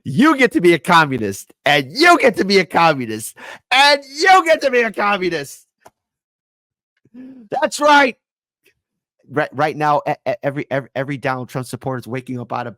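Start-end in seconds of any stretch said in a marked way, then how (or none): 0.0-1.5 louder, hammed-up prize-announcer call; mock giveaway host. Game-show giveaway parody. 1.7-3.3 loud, hammed-up announcer voice handing out prizes; mock giveaway host. Game-show giveaway parody, building. 3.7-8.2 much higher, loud hammed-up announcer screech; mock giveaway host at full pitch. Giveaway parody at its peak.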